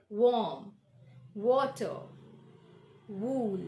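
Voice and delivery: warm, water, wool with round-lipped w; careful isolated model words. Shown as a demonstration. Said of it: "make the shape of our lips round".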